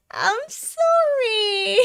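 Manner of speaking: high, drawn-out wailing apology; hammed-up sobbing, stretched right out; Kobeni. Streamer voice acting the hopeless character, tearful wail. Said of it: "This is Kobeni voice acting at its peak".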